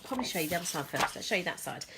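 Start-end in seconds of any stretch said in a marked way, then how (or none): none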